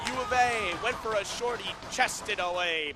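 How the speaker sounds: loud, high burst, settling as the save lands; excited play-by-play. Real, not acted. Commentator calling a shorty chested away, excited play-by-play. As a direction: cry out the dangerous chance, then ease down.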